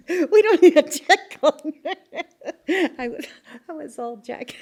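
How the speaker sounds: loud, high, slowed by laughter; laughing hard. Retold or imitated. Miner mimics coworkers not checking on her, laughing loudly. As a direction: burst out laughing through the quote.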